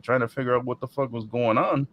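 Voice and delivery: normal talking voice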